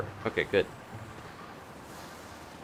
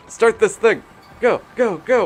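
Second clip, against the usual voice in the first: high loud panicked go go go; frantic urging; 10 dB louder than usual. Real, not acted. Gamer fleeing a horror moment, shrill frantic yells. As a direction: yell at the vehicle in a panic.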